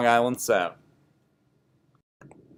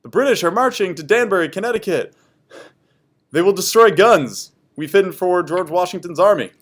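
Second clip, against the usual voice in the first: higher, louder urgent report; higher loud urgent news; breathless warning; 8 semitones higher, 6 dB louder than usual. Acted. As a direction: rush the bad news out. Messenger gives warning.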